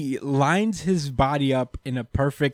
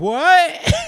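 high, far surprised squeak; shocked what; 13 semitones higher, 6 dB louder than usual. Retold or imitated. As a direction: squeal it in disbelief. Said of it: "everybody's like".

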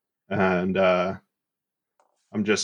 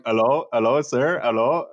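a lightly voiced, higher caller saying hello; imitating scam caller; 4 semitones higher than usual. Mocking the scam caller on the line, lightly higher. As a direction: mimic the confused scam caller. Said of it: "you can hear 'em going".

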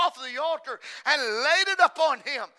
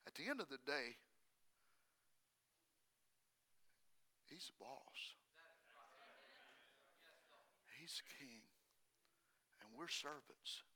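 soft, low and intimate; hushed sermon point; hushed preaching; 12 semitones lower, 30 dB quieter than usual. Real, not acted. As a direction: bring it down to a near hush.

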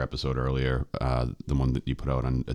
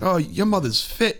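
high, slow, badly faked British accent; shitty UK accent; slower than usual. British guest admiring his host's mom, high mock British accent. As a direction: leer it as a mock British lad, pitched way up. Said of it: "I have a shitty U K accent".

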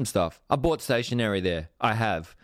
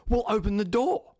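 a much higher, matter-of-fact manager voice; brisk manager; 11 semitones higher than usual. Retold or imitated. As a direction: toss it off like the boss settling it. Manager overruling the staffer.

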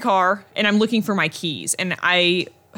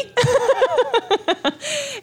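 high burst of laughter. Real, not acted. Laughing at own retort.

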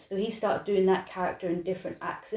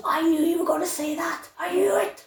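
high, excited call-back from the crowd; excited prisoner calling out; 10 semitones higher, 3 dB louder than usual. Retold or imitated. Prisoner in the audience shouting back at the dancer, high and excited. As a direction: blurt it out like a thrilled inmate who guessed the line. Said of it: "one of them goes".

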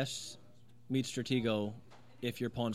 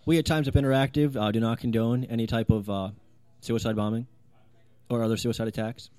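stiff official disclaimer, loud; mock PSA; 5 dB louder than usual. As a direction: read it like a corporate PSA. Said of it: "P S A".

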